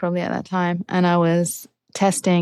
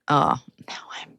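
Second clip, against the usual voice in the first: whispered dread; 4 semitones higher, 4 dB quieter, much slower than usual. Retold or imitated. Retold thought while crashing.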